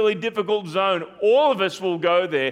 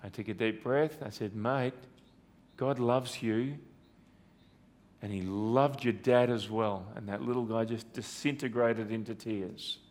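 much softer and lower, almost hushed; hushed and tender; 7 semitones lower, 9 dB quieter than usual. Real, not acted. Preacher recalls comforting a grieving boy, hushed and tender. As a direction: drop right down as the memory hurts.

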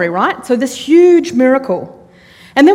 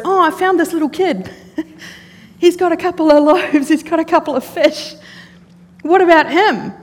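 eager offer; 5 semitones higher than usual. Retold or imitated. Andrew eagerly offering boy's food.

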